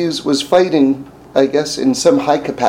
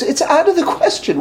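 official refusing. Retold or imitated.

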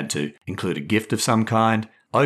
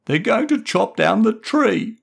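put-on high, slow, upset little boy; upset child; 13 semitones higher, 4 dB louder than usual. A narrator's reading. Boy in the tree telling his dad, upset and slow. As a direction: plead through tears, slowly.

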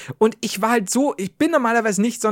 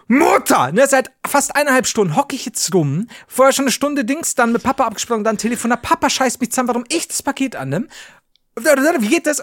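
higher, heated complaining rant; angry rant; 6 semitones higher than usual. Retold or imitated. Son ranting at his mother over the package mix-up, heated. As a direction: vent it all at once, pitched up. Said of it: "Und ich war so sauer".

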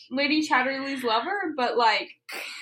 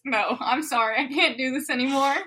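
usual speaking voice

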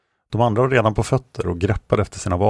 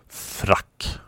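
short, breathed warning; curt whisper; 5 dB quieter than usual. A narrator's reading. Fosse warning the group of attack, whispered and curt. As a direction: hiss the one word tersely. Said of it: "sa Fosse kort".

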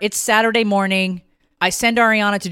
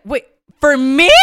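surprised daughter; 8 semitones higher, 9 dB louder than usual. Retold or imitated. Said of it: "she's like".

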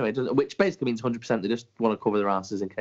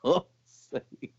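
higher, drawn-out laughing curse; laughing groan; 7 semitones higher, much slower than usual. Real, not acted. Cracking up at the cohost's terrible pun. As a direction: laugh through the exasperation.

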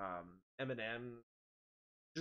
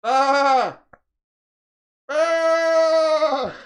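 high, long, held "aah"; sudden piercing comic yell; loud mock scream; 14 semitones higher, 16 dB louder than usual. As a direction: scream it out like the startled guy in the video. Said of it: "walks up to him and goes".

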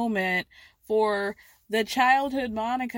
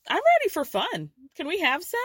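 higher, bubbly party invitation; mother calling the group to party, in a higher, eager voice; mock party excitement; 10 semitones higher than usual. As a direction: pitch it up like an eager hostess ready for fun. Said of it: "Linda goes".

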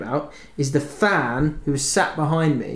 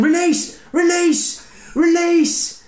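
high yells climbing higher each time; mock fan shout; 4 dB louder, much slower than usual. Football fan yelling at the player to pass, climbing yells. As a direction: bellow it from the stands, more desperate each time. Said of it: "do this sort of shout talking".

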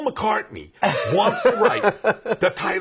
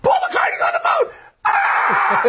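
shrill high panicked shriek; screaming secretary; 6 dB louder, slower than usual. Retold or imitated. Secretary bursting in on the director, shrieking with excitement. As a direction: burst in shrieking his name. Secretary announces [name] on phone.

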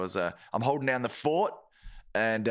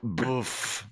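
French scoff; 2 semitones lower than usual. Pretend. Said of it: "Mister Frencho".

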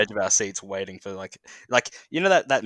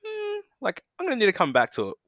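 pondering; 11 semitones higher than usual. Retold or imitated. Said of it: "you're like".

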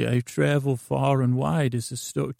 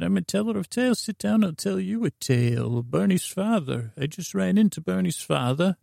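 higher, eager showman pitch; eager storyteller voice; 7 semitones higher than usual. Acted. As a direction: sell yourself like a travelling bard.

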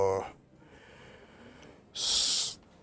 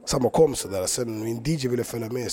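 ordinary talk, nothing marked

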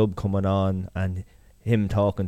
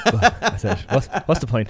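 a light laughing retold thought; 3 semitones higher than usual. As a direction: chuckle your way through the quote. Retelling a text she nearly sent, laughing.

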